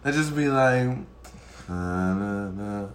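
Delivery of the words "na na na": flat, low mock drone of the girls; droning mock monotone, lower and softer; monotone imitation. Mocking girls who talk monotone, low droning. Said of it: "All monotone".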